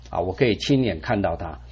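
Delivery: hushed, reflective echo. Shown as a demonstration. Echoed softly, lower.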